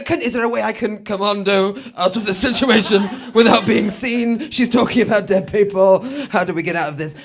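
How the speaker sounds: whisper-plot an escape in mock alarm; mock panic. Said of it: "people go".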